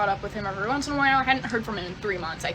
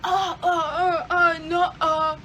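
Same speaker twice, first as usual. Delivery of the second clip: higher, slow flustered stammer; stammering ex-husband; 7 semitones higher, 3 dB louder, slower than usual. Retold or imitated. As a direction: play him caught out and squirming. Voicing husband caught lying.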